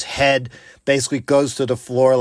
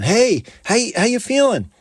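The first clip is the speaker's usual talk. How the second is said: cheerful greeting; 10 semitones higher, 3 dB louder than usual. Retold or imitated. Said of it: "this teacher had said".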